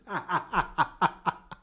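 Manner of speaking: amused cackle in quick pulses; laugh. Real, not acted. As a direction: burst out giggling at your own joke. Amused at own punchy impression.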